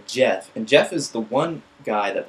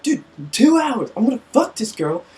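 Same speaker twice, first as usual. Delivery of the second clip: put-on drunk drummer; 13 semitones higher, 6 dB louder than usual. Drunk drummer begging for time.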